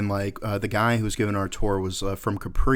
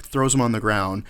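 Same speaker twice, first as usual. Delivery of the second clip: plain reading voice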